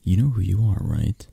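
ordinary delivery

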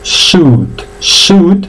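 "suit" as long "sut", no I sound; each "suit" slow and stretched, "suuut"; silent I. Shown as a demonstration. Teacher models it for learners, "suit" with silent I. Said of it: "Entonces se pronuncia suit".

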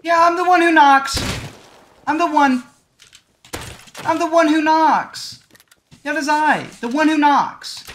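loud, high villain boasting; megalomaniac rant. Pretend. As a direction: bellow it like an unstoppable villain. Megalomaniac boasting while playing.

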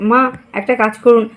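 normal talking voice

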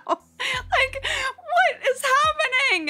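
high laughing, slow what is happening; helpless high laugh; laughing exasperation. Real, not acted. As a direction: laugh through the frustration.

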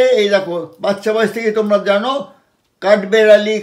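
ordinary talk

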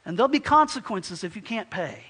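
loud, stern warning; threatening landlord. Acted. Landlord to tenant who can't pay rent, loud threat. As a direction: lay down the law forcefully. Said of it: "he threatens you and says".

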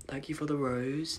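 ordinary talk, nothing marked

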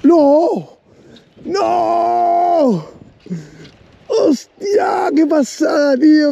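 high thrilled cries of disbelief; excited shouting. Real, not acted. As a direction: shout in real shock. Fish strike, shock and excitement. (gritos de incredulidad)

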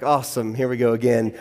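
dry sigh of here we go again; sarcastic resignation. Retold or imitated. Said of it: "I'm like".

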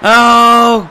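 long, loud sustained oh; loud drawn-out moan of frustration; exasperated groan. Real, not acted. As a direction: groan loudly on one held note. Groans at the play.

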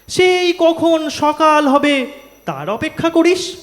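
high-pitched, emphatic rhythmic complaint; dramatic verse recitation. Acted. Poet voicing Tagore's birthday grievance to an audience, animated high-pitched recitation.

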